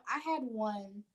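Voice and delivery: ordinary talk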